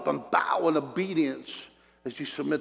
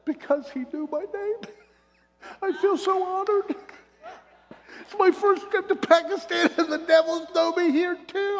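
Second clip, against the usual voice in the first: laughing high and bright through the words. Real, not acted. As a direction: giggle it out, tickled. Laughing joyful preacher aside.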